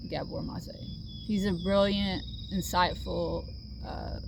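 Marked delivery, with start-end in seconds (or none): 0.1-0.7 soft, lower whisper of a name. Real, not acted. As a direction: breathe the name with affection. Fond mention of a favourite author.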